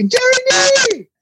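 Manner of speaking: high excited shout of a name; fan shouting name. Retold or imitated. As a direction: call out across traffic like a thrilled fan. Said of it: "One guy saw me".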